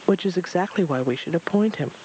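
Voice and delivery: ordinary delivery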